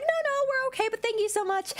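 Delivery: high, sing-song politeness; imitated polite refusal. Shoppers declining a clerk's help, imitated high politeness. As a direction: politely brush off the shop assistant.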